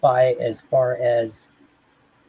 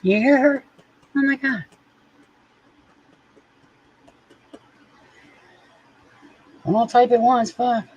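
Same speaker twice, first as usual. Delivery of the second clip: pet voice; 12 semitones higher than usual. Acted. High voice to cat and chat.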